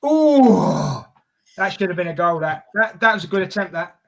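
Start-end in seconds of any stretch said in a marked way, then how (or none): 0.0-1.1 loud, drawn-out "oh", falling away; near-miss groan. Real, not acted. Watchalong host sees Diaz nearly score, loud falling groan. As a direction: let out a big falling "oh".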